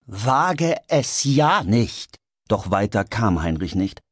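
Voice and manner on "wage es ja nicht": slow, high, drawn-out threat; furious, deliberate forbidding; menacing warning. Acted. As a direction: stretch every word into a warning. George warns off Heinrich.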